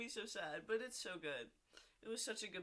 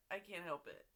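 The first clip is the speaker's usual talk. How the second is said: usual speaking voice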